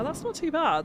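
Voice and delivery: high, bright pleased surprise. Real, not acted. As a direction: sound delighted it worked. Pleased with result.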